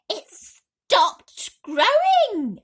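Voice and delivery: very high, loud, slow cry. A narrator's reading. Aunt cries that the peach has stopped, high. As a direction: cry it out amazed. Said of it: "Aunt Spiker cried".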